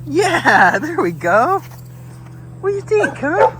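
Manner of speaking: high, cooing encouragement; encouraging dog-talk. Real, not acted. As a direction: chat brightly up high to your dog.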